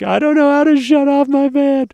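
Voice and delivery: high, loud wail of helplessness; mock panic. Van owner posting to the Facebook group, mock panic. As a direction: cry for help in hammed-up panic. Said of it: "wrote a panic post".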